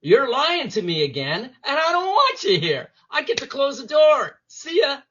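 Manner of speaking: high, forceful dismissal; telling Bob off. Retold or imitated. Speaker banishing his lying inner voice Bob, high and forceful. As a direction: kick the liar out. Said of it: "I say".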